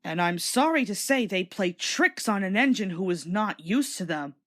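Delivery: ordinary delivery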